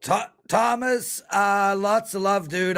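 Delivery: real emotion at a listener's recovery; emotional. Real, not acted. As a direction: say it moved, stumbling on the name. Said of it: "I got emotional".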